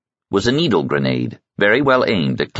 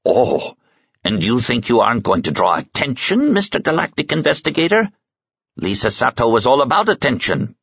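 higher, mocking old woman's voice; scornful old woman; 7 semitones higher than usual. A narrator's reading. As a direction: sneer at the investigator's naivety. Suze voiced in dialogue.

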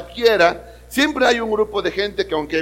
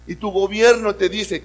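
normal talking voice

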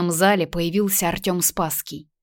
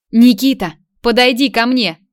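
put-on raised, higher voice to summon a child; calling out; 5 semitones higher, 7 dB louder than usual. A narrator's reading. Mother summons her running son, calling out. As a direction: call the boy over sharply. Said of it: "позвала Майя на испанском".

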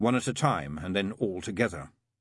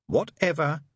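snapped, higher-pitched dismissal; irritable snap; 8 semitones higher, 4 dB louder, slower than usual. A narrator's reading. Demon too worried to argue with the angel, irritable snap. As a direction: snap it off impatiently. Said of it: "snapped Crowley".

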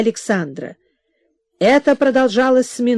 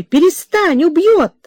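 higher and a little louder cry of alarm; frightened shout; 6 semitones higher, 4 dB louder than usual. A narrator's reading. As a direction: shout at the girl to stop before she's killed. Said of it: "закричала Марья Михайловна". (испуганный крик матери)

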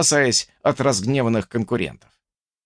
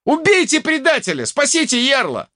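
high, rousing alarm shout; urgent cry. A narrator's reading. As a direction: scream orders to the crowd, high. Urging the crowd to attack. (тревожный призыв криком)

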